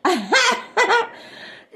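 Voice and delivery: bursting high-pitched cackle; high amused laughter. Real, not acted. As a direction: laugh out loud, high and quick. Laughing at own imitation.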